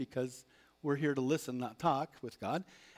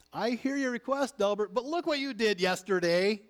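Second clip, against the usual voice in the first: a higher, louder imagined God scolding; mock God voice; 8 semitones higher, 6 dB louder than usual. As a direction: play God nitpicking over yesterday, a little higher. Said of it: "God does not say".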